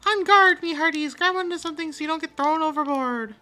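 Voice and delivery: loud, high, hearty pirate call; pirate captain shout. Acted. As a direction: bellow orders to the crew.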